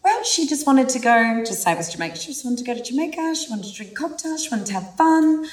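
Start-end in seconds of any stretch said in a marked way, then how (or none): 0.0-1.4 imitating a big man's high girly voice, higher; [name]'s girl voice. Big New Zealander retelling his feminine side's trip, in a mock girly voice. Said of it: "when he goes into his girl, it's fucking hilarious". 2.2-5.4 high, airy mock girly voice, getting higher on the wigs and dresses; a big guy gushing as his inner girl; [name]'s girl voice. Burly man quoting his feminine side about cocktails and wigs, in a high mock girly gush. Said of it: "when he goes into his girl, it's fucking hilarious".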